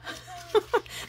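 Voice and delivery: short breathy laugh. Real, not acted. Remembering the daughter teasing mom, a short breathy laugh.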